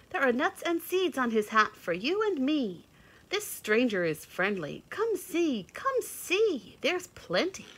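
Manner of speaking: high, happy birdy invitation; chirpy chickadee. A narrator's reading. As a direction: call the others over. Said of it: "said the chickadee dee dee".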